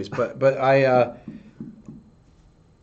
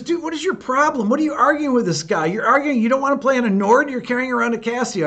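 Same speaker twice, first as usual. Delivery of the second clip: exasperated buddy; 10 semitones higher, 3 dB louder, faster than usual. Retold or imitated. Jason scolding him over the gear.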